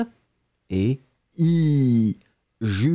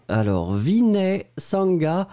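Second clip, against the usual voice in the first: normal talking voice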